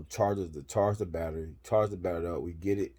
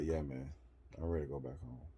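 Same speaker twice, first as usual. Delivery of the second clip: defeated; 4 semitones lower, 11 dB quieter, faster than usual. Retold or imitated. His own defeated reply retold.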